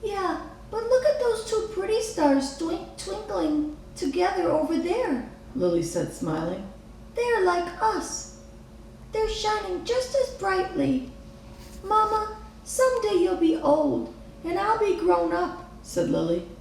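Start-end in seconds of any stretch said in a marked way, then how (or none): 0.0-5.3 put-on much higher child voice, fond; a girl smiling at two stars; smiling little girl. A narrator's reading. Lily said, smiling. 7.1-15.6 put-on much higher child voice, loving; a daughter promising her mother; tender little girl. A narrator's reading. Lily speaks to Mama.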